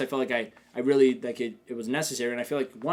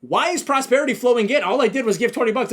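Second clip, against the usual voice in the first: high, loud incredulous question; retold astonishment, pitched up and loud; amazed reaction; 9 semitones higher, 8 dB louder than usual. Retells own amazed reaction to luck.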